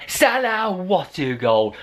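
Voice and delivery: high, thrilled commentator call; excited call. Retold or imitated. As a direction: shout the name as the goal goes in. Live goal retelling, excited call.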